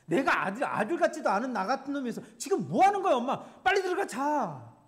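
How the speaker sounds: loud, high, tearful yelling at mother; choked-up angry outburst. Pretend. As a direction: shout in anguish at mom for waiting up. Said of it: "그런 화 있잖아요". (엄마에게 울먹이며 소리치는 아들)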